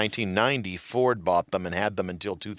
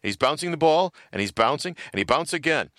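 raised, singsong play-by-play imitation; imitated clueless announcer; 6 semitones higher than usual. Retelling an athlete's commercial as announcer, mock commentary. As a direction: narrate each bounce as if it were news. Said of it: "cut to him as an announcer".

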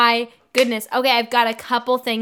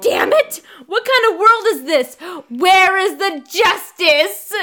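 high, loud melodramatic outburst; mock scream; 6 semitones higher, 5 dB louder than usual. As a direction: cry out in hammed-up outrage at the world. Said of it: "And then she screams".

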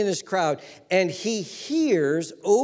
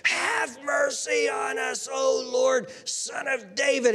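high, drawn-out pleading shout; louder crying out; 7 semitones higher, slower than usual. Acted. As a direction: cry it slowly, pitched way up. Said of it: "the decibels went up by ten or fifteen".

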